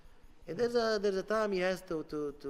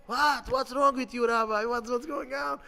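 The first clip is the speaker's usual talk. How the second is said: raised, higher voice of alarm; agitated questioning; 6 semitones higher, 5 dB louder than usual. Retold or imitated. Yeshiva boys confronting their rabbi, agitated questioning. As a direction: demand an explanation, pitched up.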